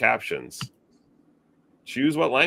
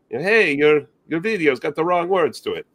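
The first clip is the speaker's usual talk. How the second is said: higher, louder mock Italian accent; bad Italian accent; 6 semitones higher, 5 dB louder than usual. Caller from Italy complaining about the subtitles, bad Italian accent. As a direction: put on a badly faked Italian caller. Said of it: "That's a really bad Italian accent".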